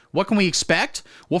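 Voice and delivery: ordinary talk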